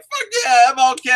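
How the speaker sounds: hammed-up fist-pump cheer; mock hype. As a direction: cheer way too hard to fit in. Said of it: "You're like".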